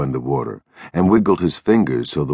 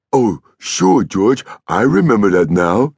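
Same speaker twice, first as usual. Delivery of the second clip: put-on higher, louder, childlike; eager Lennie; 6 semitones higher, 4 dB louder than usual. A narrator's reading. Lennie assuring George he remembers, eager. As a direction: pipe up, pleased to remember.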